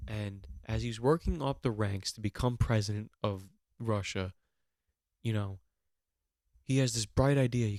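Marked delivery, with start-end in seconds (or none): none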